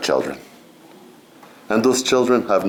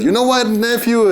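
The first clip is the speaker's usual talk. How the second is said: much higher-pitched uncle voice; imitated uncle; 13 semitones higher than usual. Uncle to nephew in the sweat lodge, high mock voice. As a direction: call out as an uncle to his nephew. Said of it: "My uncle said".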